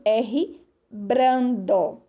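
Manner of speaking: brando said slowly and drawn out; slow, stretched. Shown as a demonstration. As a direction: draw out R brando. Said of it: "é pronunciada como". (R brando devagar)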